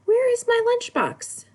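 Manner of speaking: put-on child's high question; child asking. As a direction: play a lost little kid asking teacher. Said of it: "you come to your teacher and you say".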